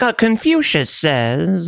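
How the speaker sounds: slow, high put-on sage voice; mock wise sage. Friend mocks the guest as a sage, slow and high. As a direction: drawl it like a fake old sage. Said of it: "He's like".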